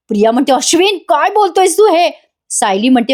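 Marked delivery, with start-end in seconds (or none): 0.5-2.1 high-pitched mock disbelief; imitated shocked protest. Priya answers the husband disowning her, high imitated shock. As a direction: protest as a stunned wife, lightly acted.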